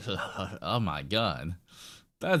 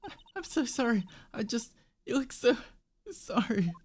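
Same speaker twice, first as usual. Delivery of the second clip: high, fast flustered apology; flustered Maya; 14 semitones higher than usual. Acted. Assistant apologising to the director, high and rushed. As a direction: rush the sorry, pitched high and embarrassed.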